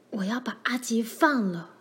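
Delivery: whispered, strained resolve; hoarse whisper. A narrator's reading. Laura decides to free the dog, whispering hoarsely. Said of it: "罗兰嘶哑着嗓子说".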